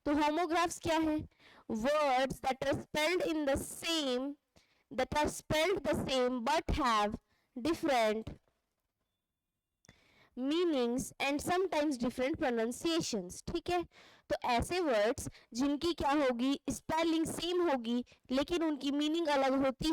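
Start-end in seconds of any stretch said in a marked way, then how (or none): 1.7-13.5 slow dictation. Shown as a demonstration. Reads definition in class.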